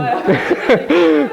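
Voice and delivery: high trailing laugh; high laugh that falls away. Real, not acted. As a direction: keep laughing. Continues laughing about the brother.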